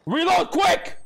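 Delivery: high, panicked call to reload; yelling in a hurry for the reload; urgent shout. Real, not acted. Panicked gamer urging reload.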